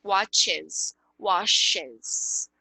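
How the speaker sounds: WATCHES and WASHES with a clear -ez syllable; sounded E. Shown as a demonstration. Teacher stressing the E, WATCHES and WASHES said watch-ez, wash-ez. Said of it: "importante você falar esse E".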